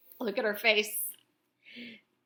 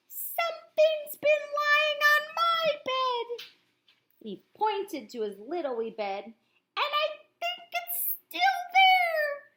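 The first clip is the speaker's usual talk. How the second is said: little bear singsong; 5 dB louder than usual. A narrator's reading. Little Wee Bear pointing at his bed, high singsong. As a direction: sing it out like a small cub.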